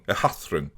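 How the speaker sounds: Ehathron lisped, th in the middle, soft. Shown as a demonstration. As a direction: lisp the word Ehathron gently. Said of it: "I'm doing it with a bit of a lisp".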